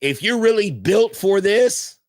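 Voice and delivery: rally the team; hyped challenge. Real, not acted. Host challenging the team, hyped.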